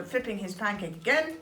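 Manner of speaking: ordinary delivery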